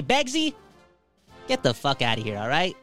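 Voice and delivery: normal talking voice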